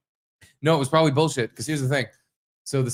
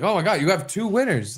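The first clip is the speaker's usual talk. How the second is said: high, swooping retold excitement; excited amazement; 7 semitones higher than usual. Telling partner about winning tickets, high excited retelling. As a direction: marvel at the double win, pitched up.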